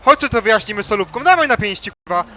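furious, challenging yell; angry shouting. Acted. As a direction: pick a fight, loud. In-game fight threat.

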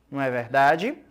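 ordinary talk, nothing marked

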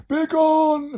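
very high, loud screamed command. Acted. Twins banish the slime, screaming. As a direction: scream it out, stretched. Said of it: "they both screamed".

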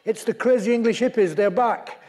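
high, loud mock shout; imitating a Frenchman's excited holler. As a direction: call it down as the French climber. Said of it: "this Frenchman shouted down".

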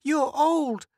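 put-on high firm little girl; firmly. Girl turning away the older boy, firm. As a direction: state it flatly, no debate. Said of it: "said Mink firmly".

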